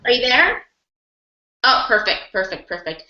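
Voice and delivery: usual speaking voice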